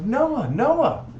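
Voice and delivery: high, loud cry for Noah; alarmed son. Acted. Son alerting Noah to trouble aboard, urgent high shout. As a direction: call out urgently to your father.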